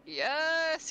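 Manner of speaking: usual speaking voice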